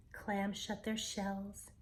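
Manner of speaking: ordinary delivery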